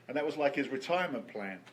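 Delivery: ordinary delivery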